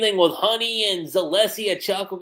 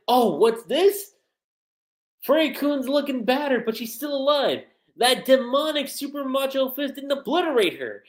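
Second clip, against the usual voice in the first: a touch higher, commentator excitement; lightly voiced announcer; 8 semitones higher than usual. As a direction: narrate the fight like a hyped commentator. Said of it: "the announcer's like".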